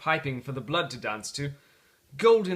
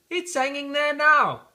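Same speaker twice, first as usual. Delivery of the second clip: put-on much higher, amazed child; a boy's voice; 12 semitones higher, 8 dB louder than usual. The boy in the street asking about the turkey, amazed. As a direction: squeak it out like an astonished small boy.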